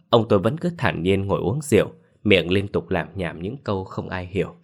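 ordinary delivery, nothing marked

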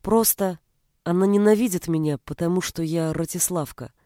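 plain reading voice

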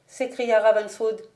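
ordinary delivery, nothing marked

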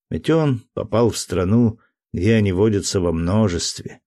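ordinary delivery

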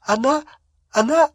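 very high, stammering through tears; acted tearful, high-pitched stutter; weeping protest. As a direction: plead your innocence between sobs, breaking up the words. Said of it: "расплакалась пуще прежнего".

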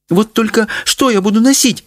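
high-pitched, distressed complaint; lamenting. A narrator's reading. Mother fretting over her clothes, lamenting. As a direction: bemoan it in a high voice. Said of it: "сокрушалась мать".

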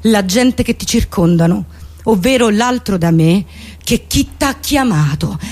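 usual speaking voice

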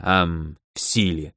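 low, glum, halting finish; gloomy. A narrator's reading. Dennis stung by remark about dim fire mages, gloomy. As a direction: drop low and grumble it. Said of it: "хмуро ответил Деннис".

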